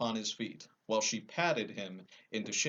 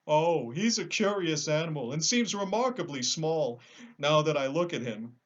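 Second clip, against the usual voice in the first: sad lion; 6 semitones higher, 6 dB louder than usual. Acted. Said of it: "continued the lion sadly".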